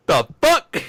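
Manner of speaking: high, loud mock outburst; imitated startled yell; shocked quote. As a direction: blurt it in mock disbelief. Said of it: "Then just".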